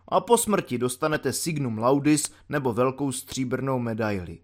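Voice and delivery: plain reading voice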